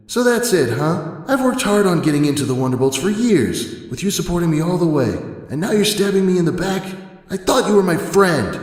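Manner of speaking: loud, high and wounded, accusing her friend; hurt, angry outburst. Acted. Dash yelling at Twilight over being grounded. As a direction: shout it like a betrayed friend.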